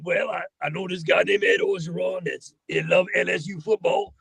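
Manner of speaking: lightly voiced bayou coach; a hint of a Louisiana football coach; Ed Orgeron. Actor pitches a voice for the rat, coach impression. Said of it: "they asked me to come up with a voice".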